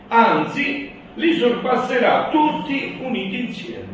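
preaching at full force. Acted. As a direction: proclaim it like a preacher. Reads Jesus' words aloud.

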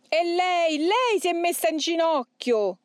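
high, awed demon voice; fearful reverence. A narrator's reading. Demon seeing the Madonna kneel, fearful awe. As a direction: exclaim in dread and unwilling awe. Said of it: "tono di voce che rivelava timore".

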